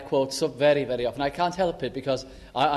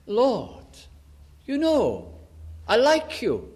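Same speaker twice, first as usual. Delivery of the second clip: high-pitched, each phrase falling; pleading protest; 8 semitones higher, slower than usual. Retold or imitated. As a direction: imitate a high, insistent plea. Acts out Peter's evasive answer to Jesus.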